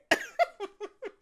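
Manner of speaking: shrill delighted giggle; high laugh. Real, not acted. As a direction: cackle with glee. Laughing after romance remark.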